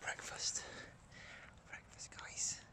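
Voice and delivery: soft whisper to the viewers; real low-volume whisper; hushed announcement. Real, not acted. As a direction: whisper it to the camera. Keeping quiet while fishing.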